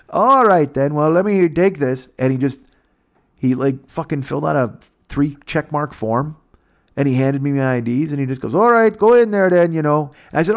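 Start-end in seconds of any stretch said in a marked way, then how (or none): 0.1-2.1 Canadian officer voice. Retold or imitated. 8.5-10.1 Canadian officer voice. Retold or imitated.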